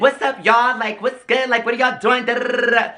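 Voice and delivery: mock party girl voice; mimicking a bubbly stranger. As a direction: imitate the chatty girl at the bar, up high. Mimicking the bubbly woman at the bar.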